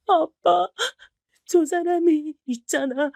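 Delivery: weep it out to her father; sobbing. Acted. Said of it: "엉엉 울면서 말을 해버렸답니다". (엉엉 울면서 아빠에게 털어놓는 목소리)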